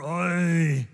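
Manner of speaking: a groggy Yiddish "Oy"; Yiddish accent. Retold or imitated.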